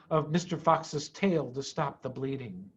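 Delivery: ordinary delivery, nothing marked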